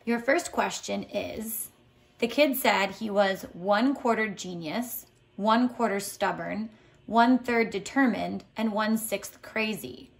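ordinary delivery

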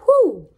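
excited whew. Real, not acted. Reacting after reading the verse.

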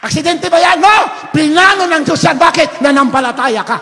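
high, shouted question and answer; forceful preaching. Real, not acted. Preacher denies it was accident, emphatic shout. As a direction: hammer each phrase at full voice.

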